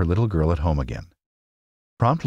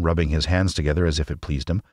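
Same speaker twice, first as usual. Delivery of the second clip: ordinary delivery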